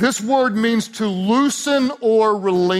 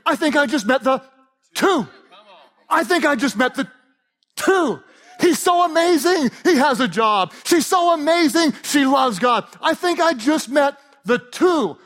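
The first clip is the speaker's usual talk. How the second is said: excited gushing; 8 semitones higher than usual. Acted. Pastor mocking a smitten single, high excited gush.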